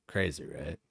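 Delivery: normal talking voice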